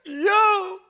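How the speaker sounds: a high yelp through real laughter; laughing hard at his own story. Real, not acted. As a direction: burst out laughing. Laughing fit over son story.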